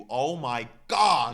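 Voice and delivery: loud, drawn-out swoon; swooning outburst. Real, not acted. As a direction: gush it out loudly, stretching every word. Gushing over Nigel Barker.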